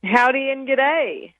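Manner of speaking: hammed-up howdy with a g'day; playful greeting. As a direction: stretch it out cheerfully, half Texan, half Aussie. Guest's playful Texan-Aussie greeting.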